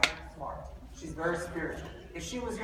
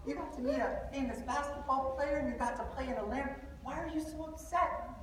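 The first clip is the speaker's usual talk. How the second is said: softer, higher worried father; concerned dad; 6 semitones higher, 8 dB quieter than usual. Retold or imitated. Father asks his upset son what's wrong, soft and high. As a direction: gently ask your sad son what's wrong. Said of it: "I said".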